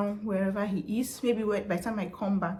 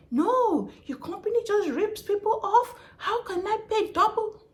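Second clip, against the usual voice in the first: mimicking an outraged customer; 12 semitones higher than usual. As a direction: shriek like a cheated caller. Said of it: "angry customer cuts her off".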